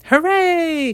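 high cheer of the animals; a happy shout; high excited hooray. A narrator's reading. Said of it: "cried everybody".

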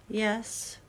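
barely voiced, reluctant yes; almost a whisper. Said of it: "almost in a whisper".

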